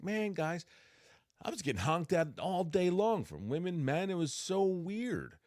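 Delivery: high-pitched baffled retelling; bewildered complaint. Retold or imitated. Telling the guys about being honked at, bewildered.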